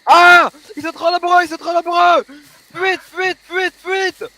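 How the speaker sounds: high-pitched cry of gamer panic; frantic, repeated high calls to flee; panicked retreat. Pretend. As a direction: shout the alarm while fleeing a horde. Fleeing an overwhelming zombie horde.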